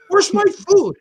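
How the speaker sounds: high, indignant mock holler; comic high-pitched demand; mock yelled demand. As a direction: imitate someone yelling for a late lunch. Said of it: "he yells, he yells".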